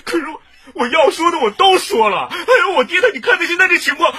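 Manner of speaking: high, loud, distressed plea; desperate pleading. Acted. Suspect pleading not to leave his sick father, desperate and high. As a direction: beg him to see your father's state.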